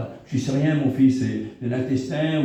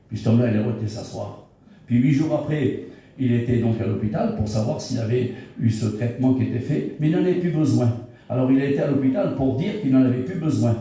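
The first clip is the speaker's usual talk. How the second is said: ordinary talk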